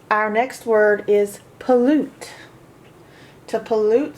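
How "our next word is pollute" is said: ordinary delivery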